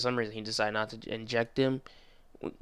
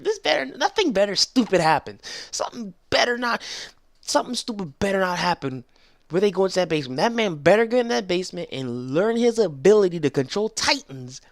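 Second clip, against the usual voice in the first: high, loud and emphatic fan demand; worked-up insistence; 8 semitones higher, 5 dB louder than usual. Real, not acted. Fan warns the show about the basement, worked up. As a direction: insist on it, really fired up.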